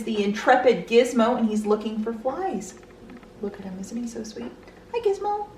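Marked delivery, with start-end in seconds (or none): 3.4-4.5 soft, tender aside; doting murmur. Real, not acted. Vlogger cooing over a pet, softly doting. 4.9-5.5 high, soft little hello; pet-greeting voice. Real, not acted. Vlogger greeting Gizmo the pet, high and sweet.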